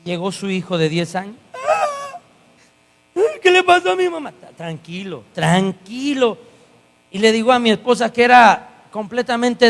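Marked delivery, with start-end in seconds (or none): 1.5-4.3 put-on high, childlike panicked question; frightened child. Ten-year-old asks what happened to his mother, frightened. 4.6-6.4 soothing. Retold or imitated. Coach calming the boy.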